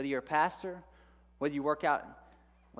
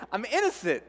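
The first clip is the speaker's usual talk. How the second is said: panicked plea; 8 semitones higher, 6 dB louder than usual. Retold or imitated. Retold panic at police stop.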